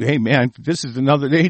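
ordinary delivery

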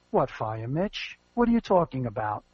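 a low, quiet, deadpan voice of playing dumb; innocent [name].

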